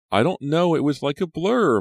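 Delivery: put-on high, loud, agitated girl; distressed. A narrator's reading. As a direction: blurt it out in a panic. Shaken Maya describes the attacker.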